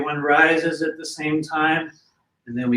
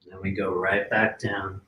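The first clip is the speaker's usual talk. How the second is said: guided breathing; 4 semitones lower, much slower than usual. Acted. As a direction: breathe with them, calm and unhurried. Leads a breathing exercise.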